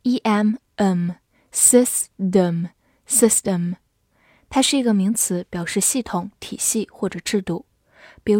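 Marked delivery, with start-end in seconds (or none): 0.0-3.7 slow word demo. Shown as a demonstration. Teacher modelling a word slowly for students. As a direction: say it slowly, clearly. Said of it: "S Y S发sys".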